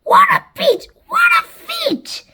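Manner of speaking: loud, squawky cheering; shouted. A narrator's reading. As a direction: cheer wildly in a character voice. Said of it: "Anne Spiker shouted".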